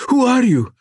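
high, loud terrified question, slowing on the second; panicked cry. Acted. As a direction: cry out at the monster in fear. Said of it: "Gessner had screamed in panic".

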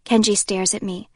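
ordinary delivery

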